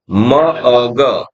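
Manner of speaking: drawn-out separate sounds म अ ग; very slow phonics blending for children. Shown as a demonstration. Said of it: "तो ऐसे ही करना है". (बहुत धीमे अलग-अलग ध्वनियाँ)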